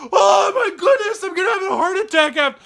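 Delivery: high, loud, startled gasp of a voice, fast; mock heart-attack shock. Surprised by friends at a party, startled and loud. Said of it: "And I'm like".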